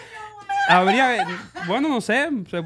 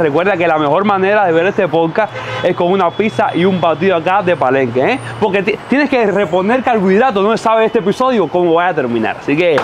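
louder, energetic sponsor read; anuncio publicitario; 3 semitones lower, 5 dB louder than usual. Acted. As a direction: sell the pizza to the podcast audience, loud. Lee publicidad de la pizzería. (anuncio publicitario con voz fuerte)

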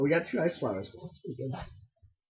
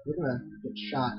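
normal talking voice

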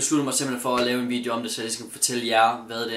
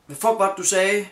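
irritating challenge; 6 semitones higher than usual. Retold or imitated. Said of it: "rigtig irriterende måde".

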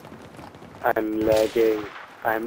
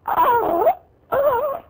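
sudden high-pitched yelps of alarm; a shocked squeal as enemies appear; startled high yelp. Real, not acted. Gamer yelps at surprise attack, high-pitched squeal.

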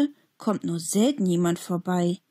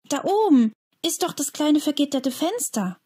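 a sudden, higher excited call; 8 semitones higher than usual. A narrator's reading. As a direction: blurt out the discovery. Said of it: "ruft er plötzlich". (plötzlich und aufgeregt rufen)

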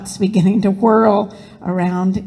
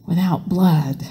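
ordinary delivery, nothing marked

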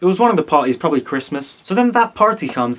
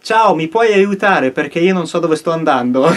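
hammed-up native Italian delivery; Italian accent; 3 semitones higher, 4 dB louder than usual. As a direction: speak in fluent, emphatic Italian. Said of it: "Listen to that Italian accent".

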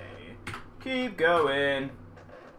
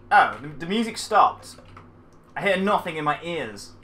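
usual speaking voice

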